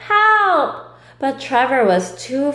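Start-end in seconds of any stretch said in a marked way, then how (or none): 0.1-0.9 high, loud cry for help; storybook shout for help; calling for help. Acted. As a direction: yell for rescue.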